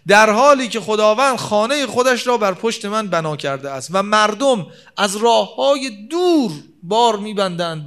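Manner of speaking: preacher voicing the proud Kaaba at full force; proud boast. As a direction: boast like the Kaaba itself from the pulpit.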